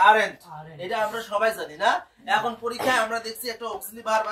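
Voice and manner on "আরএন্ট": আরএন্ট said as one contracted word; pronunciation demo. Shown as a demonstration. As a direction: model the contraction আরএন্ট clearly. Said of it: "এটা উচ্চারণ হচ্ছে". (আরএন্ট-এর উচ্চারণ দেখিয়ে বলা)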